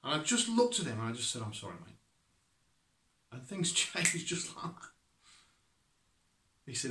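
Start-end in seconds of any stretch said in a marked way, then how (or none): 1.4-2.0 much softer, gentle and sincere; calm apology. Retold or imitated. Cyclist apologising to the driver, soft and sincere. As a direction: let it out quietly after a breath. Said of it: "I just took a breath".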